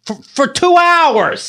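high, slow, drawn-out complaint; exasperated exclamation. Real, not acted. He complains how long the exercise ran, high exasperated wail. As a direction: stretch it high in disbelief.

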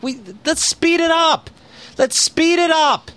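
high, slow, impatient pleading. Retold or imitated. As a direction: beg them to hurry. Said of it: "I'm like".

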